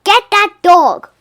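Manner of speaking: very high, loud, slow witch's shout. A narrator's reading. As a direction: screech the order after her. Witch shouting after dog.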